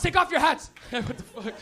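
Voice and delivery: usual speaking voice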